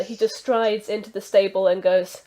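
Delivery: normal talking voice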